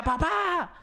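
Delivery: retold urgent call for a parent; slightly desperate child's call. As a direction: imitate a child calling dad, high-pitched. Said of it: "como un poquito desesperado, me, me grita".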